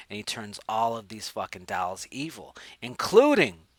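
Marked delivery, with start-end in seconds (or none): 2.8-3.7 "including" loud, high on the middle syllable; dramatic emphasis. Real, not acted. Host listing the evil dolls, dramatically emphatic. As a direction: punch "including" with a big high swell.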